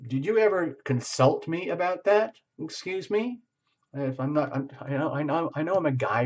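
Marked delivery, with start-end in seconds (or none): 0.1-3.4 affronted. Retold or imitated.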